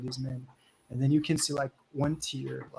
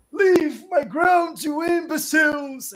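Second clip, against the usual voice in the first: put-on furious old man; 14 semitones higher, 12 dB louder than usual. Old man yelling at the goblins, furious.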